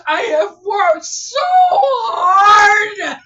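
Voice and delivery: crying it out in relief; sobbing with joy. Real, not acted. Creator sobbing because her store finally ranks on Google, tearful joy.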